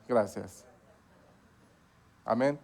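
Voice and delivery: ordinary talk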